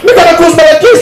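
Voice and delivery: high shouted retort; mock yelling at him.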